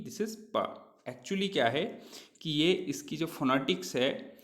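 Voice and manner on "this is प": प said as the bare consonant, not the letter name; sound p. Shown as a demonstration. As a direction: contrast letter P with प. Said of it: "This is not P". (अक्षर नहीं, सिर्फ़ प की ध्वनि)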